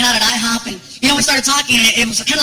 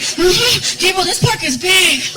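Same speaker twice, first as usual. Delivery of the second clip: put-on winded kid; 7 semitones higher, much slower than usual. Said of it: "he starts getting winded".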